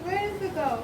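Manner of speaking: high, curious motherly voice; talking to a toddler. Acted. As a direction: ask the little one gently. Mother chats with small daughter.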